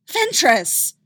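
high, loud shout; yell. A narrator's reading. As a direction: yell her name in shock. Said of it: "Ahsoka yelled".

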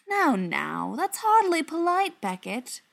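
smooth mocking villain, rising on polite; a mock-scolding villain tone; suave villain. A narrator's reading. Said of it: "Gerard said".

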